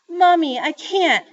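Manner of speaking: put-on high, loud child's wail; tearful. A narrator's reading. Joey refuses her mother's order, tearfully. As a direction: plead as a little girl starting to cry. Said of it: "The tears really started coming".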